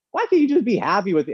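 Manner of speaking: high, offended retort; indignant protest. Retold or imitated. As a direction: defend yourself, stung. Indignant reply to mom.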